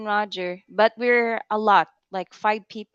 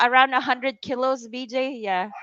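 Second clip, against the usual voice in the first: ordinary delivery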